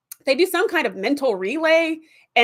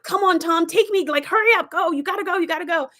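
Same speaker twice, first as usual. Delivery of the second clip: higher, pushy coaxing; urgent ship voice; 7 semitones higher than usual. Retold or imitated. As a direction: hurry him along like a temptress. Ship urging Tom onward.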